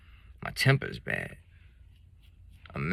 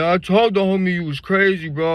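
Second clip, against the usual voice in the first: put-on drunk friend; 11 semitones higher, 8 dB louder than usual. Drunk friend voiced high.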